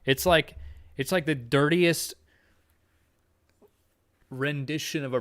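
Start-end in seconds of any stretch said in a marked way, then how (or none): none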